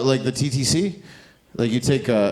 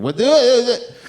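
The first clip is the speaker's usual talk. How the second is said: dad's yell, high and loud; mock yell; 12 semitones higher, 7 dB louder than usual. Dad yelling at the kid during violin practice, mock yell. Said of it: "yelling at the kid".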